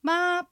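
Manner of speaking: ま held high and level; first tone. Shown as a demonstration. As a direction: say ま on one high, even note. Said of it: "続けて四つ発音しましょう". (ま を高く平らに伸ばす第一声)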